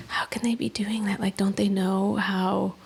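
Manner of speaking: whispered, breathy disbelief; hushed inner thought. Retold or imitated. Host recalling her depressed thoughts at the window, whispered. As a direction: think it aloud in a soft whisper.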